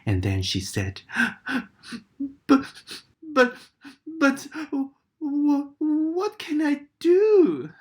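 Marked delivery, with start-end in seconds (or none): 2.5-7.7 high, slow, stammering through tears; tearful crocodile. A narrator's reading. Crying crocodile stammering to Katy, slow and high. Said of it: "crocodile began to cry too".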